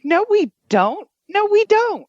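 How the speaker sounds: high, drawn-out mock-outraged protest; indignant retort. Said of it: "I'm like".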